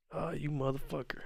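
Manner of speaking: ordinary delivery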